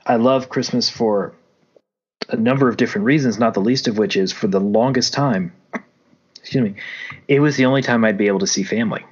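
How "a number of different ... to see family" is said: usual speaking voice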